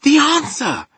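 high, loud, triumphant; excited cry. Acted. Sophie announcing her solution to the giant, excited cry. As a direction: cry it out like a breakthrough. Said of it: "cried Sophie".

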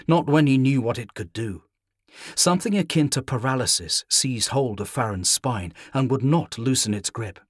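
ordinary delivery

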